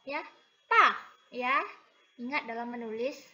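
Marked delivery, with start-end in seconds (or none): 0.7-1.6 pa loud, falling sharply; fourth tone pa. Shown as a demonstration. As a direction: model pa as fourth tone. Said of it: "Karena ada keempat". (pa dengan nada keempat)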